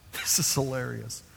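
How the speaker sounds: ordinary talk, nothing marked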